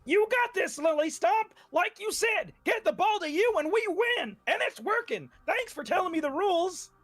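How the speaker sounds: high, bright-eyed cheer to a teammate; cheery character voice. Acted. Bleeding player cheers teammate on, high and sincere.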